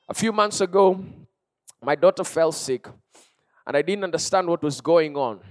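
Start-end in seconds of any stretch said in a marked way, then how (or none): none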